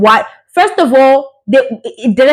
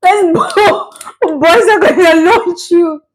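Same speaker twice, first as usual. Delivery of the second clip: high-pitched, laughing through the words; real laughing fit; 6 semitones higher, slower than usual. Real, not acted. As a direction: try to talk while cracking up. Breaking up laughing, apologizing.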